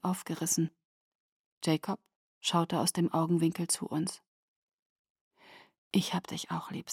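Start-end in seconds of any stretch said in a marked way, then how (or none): 5.9-6.9 soft unvoiced farewell whisper. A narrator's reading. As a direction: breathe it tenderly.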